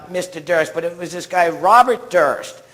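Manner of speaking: ordinary delivery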